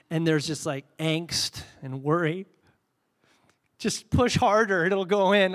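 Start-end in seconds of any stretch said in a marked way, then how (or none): 3.8-5.5 acted insistence, louder and higher; mock-urgent pushing for it to work; insistent urging. Retold anxious battery argument with child.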